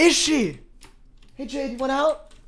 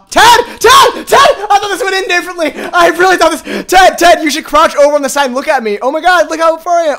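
panicked yelling; 3 semitones higher, 7 dB louder than usual. Real, not acted. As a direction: yell for Ted in a rising panic. Panicked yelling while playing game.

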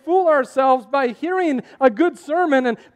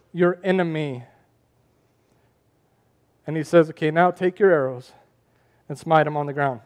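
hushed preacher narrating Kings; 8 semitones lower than usual. Acted. Quiet retelling of the arrows.

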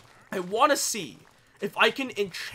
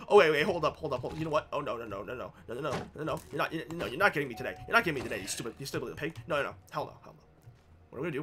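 ordinary talk

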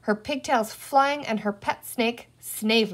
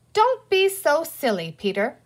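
mildly cross scolding, starting high and louder; 6 semitones higher, 4 dB louder than usual. A narrator's reading. Girl scolds her brother's moon game, mildly cross. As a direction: scold a silly little brother. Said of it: "Penny said crossly".

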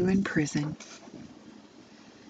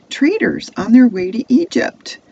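high, loud excited announcement; calling out; 8 semitones higher, 9 dB louder than usual. Acted. Brothers spotting traders from atop the well, calling out. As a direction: call it out, pitched high and loud.

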